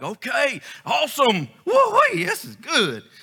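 hammed-up high whooping delight; mock excitement. Mocking super-spiritual giants who cheer at miracles, high hammed-up glee. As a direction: lampoon a giddy super-spiritual believer. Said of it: "super spiritual giants that's like".

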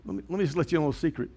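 fast, soft confiding; hurried hushed confession; quick quiet aside. Real, not acted. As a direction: rush it out like a secret.

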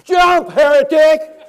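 very loud, high, drawn-out yell; outraged shout. Acted. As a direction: explode into a full-force acted scream of condemnation. Pastor turns on man on bridge.

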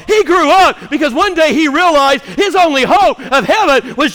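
high, loud, driving pulpit shout; fervent full-voiced sermon; impassioned preaching. Real, not acted. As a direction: preach it hard, punching the key phrases.

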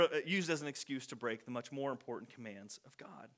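whispered aside. Real, not acted. Preacher whispering an aside to the congregation. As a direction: drop to a breathy whisper for the aside. Muttered aside about tradition.

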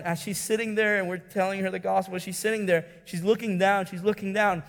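ordinary talk

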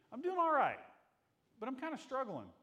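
high, loud cheerful admission of struggle; bright greeter voice owning the struggle; cheery honest reply. Retold or imitated. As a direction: chirp it out while admitting struggle. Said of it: "It's okay to say".

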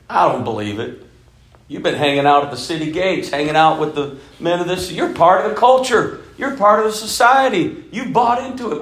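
hammed-up incredulous rant; scornful disbelief. Sons-in-law scorn Lot's warning.

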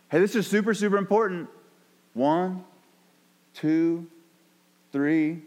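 deliberate warning count with long pauses; parent counting to three. Acted. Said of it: "like Jesus counting to three".

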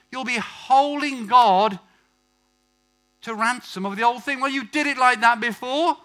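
high, insistent sermon delivery; forceful preaching. Real, not acted. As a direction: press the point home from the pulpit.

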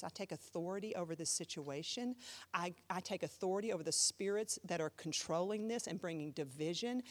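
low, hushed, firm prayer; silent prayer voiced. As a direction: pray it under your breath, quietly taking authority. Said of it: "in my mind, I didn't say anything out loud".

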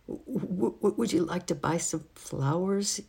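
high, stammering, shaky little voice; shaky squeak. A narrator's reading. Philip offers flowers to the angry housemaid, shaky and squeaking. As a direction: squeak it out with a nervous stutter. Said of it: "answered in a very shaky voice".

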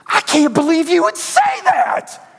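high, slow, hurt whine, half crying; high, slow, hurt whining cry; pouting whine. Retold or imitated. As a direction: pout like a wounded husband. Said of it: "I would pout".

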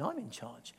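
low, soft and calm; a quiet, assured divine voice; voice of Jesus. Retold or imitated. Jesus speaking to him in a prayer meeting, low and quiet. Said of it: "It was Jesus saying".

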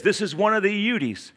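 usual speaking voice

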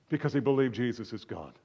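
ordinary talk, nothing marked

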